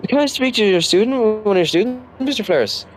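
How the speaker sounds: high-pitched mock principal voice; imitated principal. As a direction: put on a principal asking a teacher, pitched high. Acting principal addressing the teacher.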